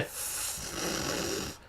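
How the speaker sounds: long soft hiss of air; imitated deflating hiss on one consonant; drawn-out fff. Customer's unfinished insult to the cashier, long airy fff. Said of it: "She just said".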